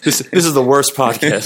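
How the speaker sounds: laughing. Real, not acted. Laughing at the chaos.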